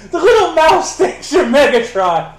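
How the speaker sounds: high, loud, laughing through the words. Acted. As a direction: cackle the words out at the mouse. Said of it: "So he's like".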